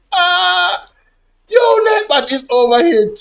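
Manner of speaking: laughing. Real, not acted. Laughing at the bot.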